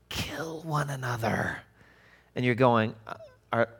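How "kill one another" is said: slow, hushed threat; drawn-out, breath-only growl of menace; menacing whisper. Acted. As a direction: whisper it slowly with intent to intimidate. Acting boxers' menace before fight.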